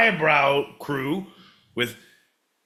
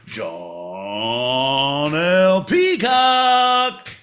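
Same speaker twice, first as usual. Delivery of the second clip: rising, louder showman intro; boxing ring announcer calling the host; hype announcer; 4 semitones higher, 3 dB louder than usual. Pretend. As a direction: build to a shouted name. Hyping the host's return.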